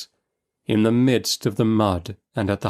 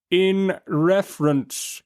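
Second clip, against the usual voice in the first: "In reference" said very slowly, every syllable crisp; extra distinctness; 9 semitones higher, much slower than usual. A narrator's reading. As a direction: spell out "In reference" firmly. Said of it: "with extra distinctness".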